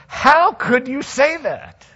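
high, slow, loud disbelief; shocked reproach. Retold or imitated. Friend reproaching the pastor in shocked disbelief. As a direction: gasp it out in dismay. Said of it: "and they said".

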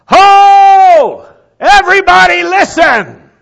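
full-voiced high shout to a crowd; booming, drawn-out town-square call; loud calling out. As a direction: holler for everyone's attention, no microphone. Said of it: "He said loudly".

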